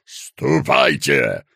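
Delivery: low, slow, barked dismissal; barked order. A narrator's reading. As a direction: bark it at him. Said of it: "рявкнул он".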